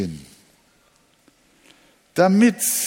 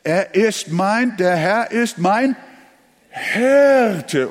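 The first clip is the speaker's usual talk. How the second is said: "Hirte" rises high and loud at the end; emphasis on "Hirte", higher and louder; stress on Hirte; 4 semitones higher, 4 dB louder, slower than usual. Shown as a demonstration. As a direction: build up to "Hirte". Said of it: "das nächste Wort ist".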